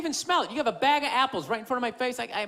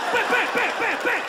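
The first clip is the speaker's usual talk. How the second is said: high, punchy bam bam sounds; mock smashing noises; 8 semitones higher than usual. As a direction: smash the muffins with your voice. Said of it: "started going fucking bam".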